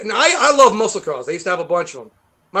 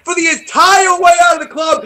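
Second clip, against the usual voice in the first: louder, higher, laughing through it; excited laughing shout; 8 semitones higher, 6 dB louder than usual. Real, not acted. Telling the interviewer the horn story, laughing shout. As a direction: belt it out, cracking up.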